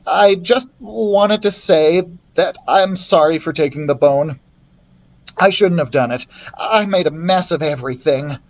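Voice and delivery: lightly voiced young thief saying sorry; Thomas's voice. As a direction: apologise with real remorse. Thomas apologising to Lewis.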